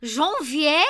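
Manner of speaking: loud, high, questioning French "Janvier?"; French pronunciation. Retold or imitated. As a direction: echo it with an exaggerated French accent, rising sharply. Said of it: "What's the French January word?".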